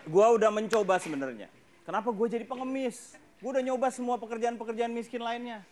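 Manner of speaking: ordinary talk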